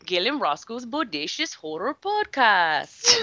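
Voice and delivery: slow, drawn-out send-up of an announcer; mocking podcast impression. Guest teasing the hosts with their show's title, slow exaggerated impression. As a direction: stretch the show title out in a hammy impression. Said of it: "That is quite the impression".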